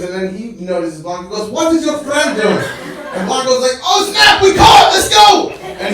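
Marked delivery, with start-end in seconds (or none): 1.5-2.6 put-on high, loud Arabic-accented challenge; raised, indignant mock-accent question; alarmed shopkeeper. Shopkeeper catches the thief. 3.8-5.5 very high, full-volume panicked yell; explosive, hammed-up getaway shout; dramatic shout. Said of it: "Blanco was very dramatic about it".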